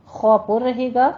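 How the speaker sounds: ordinary talk, nothing marked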